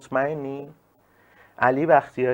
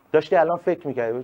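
ordinary talk, nothing marked

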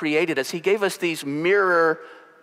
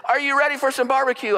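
high, excited game-day shout; hype announcer call; 11 semitones higher, faster than usual. Retold or imitated. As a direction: pump up the crowd like a stadium announcer. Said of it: "and I said".

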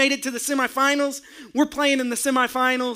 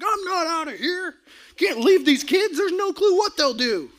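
defiant protest; 6 semitones higher than usual. Retold or imitated. Refusing ejection.

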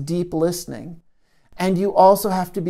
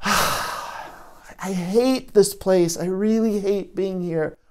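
hurting part; slower than usual. Shown as a demonstration. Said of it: "I'm the part that's hurting".

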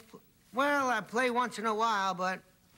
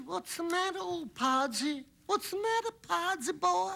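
put-on Southern accent; 10 semitones higher than usual. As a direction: play the Southern pro, fond and teasing. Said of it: "You like that Southern talk?".

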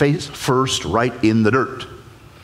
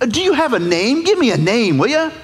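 much higher, protesting reluctant Moses; panicky Moses; 12 semitones higher than usual. Acted. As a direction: squeal your objections to the bush. Moses voiced demanding.

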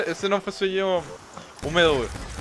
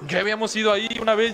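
urgent plea; 4 semitones higher than usual. Retold or imitated. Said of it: "Y yo le decía a mis papás".

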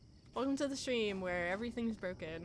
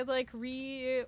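ordinary talk, nothing marked

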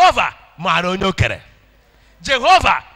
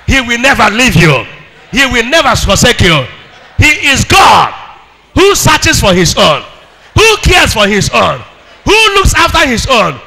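loud, high preaching cry; rising shouted sermon; full-force preaching; 4 semitones higher, 8 dB louder than usual. Acted. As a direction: preach it out to the whole church.

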